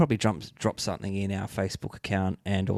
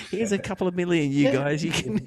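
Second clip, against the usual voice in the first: high, playful mock star voice; hammed-up generosity, pitched well up; joking generous star; 7 semitones higher than usual. Host jokingly plays a massive star remembering the show, hammed-up generosity.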